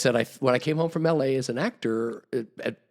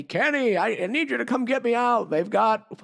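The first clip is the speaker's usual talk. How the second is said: put-on high, pleading old-man voice; pleading old man on the phone; 11 semitones higher, 3 dB louder than usual. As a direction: beg Kenny to come get you out, a bit louder and much higher. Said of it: "he's calling me".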